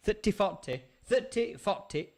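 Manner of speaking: Barnsley accent. Shown as a demonstration. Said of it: "if I'm in Barnsley".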